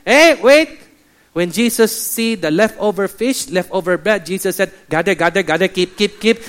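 excited mock Chinese accent; Chinese pastor. Chinese pastor adding proof for the others, mock accent. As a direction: press the point in a comic Chinese accent. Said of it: "Then the Chinese pastor said".

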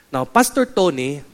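usual speaking voice

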